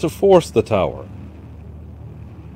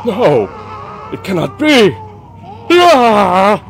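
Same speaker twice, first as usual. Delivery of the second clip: high, loud panicked outcry ending in a scream; frightened shout rising to a scream; terrified cry; 9 semitones higher, 4 dB louder than usual. Acted. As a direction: cry out in terror as demons strike. King attacked in nightmare.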